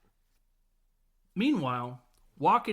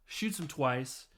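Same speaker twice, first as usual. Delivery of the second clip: normal talking voice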